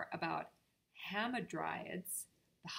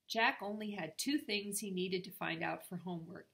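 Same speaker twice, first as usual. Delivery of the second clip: ordinary delivery, nothing marked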